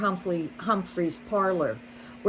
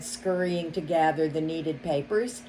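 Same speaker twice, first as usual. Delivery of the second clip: usual speaking voice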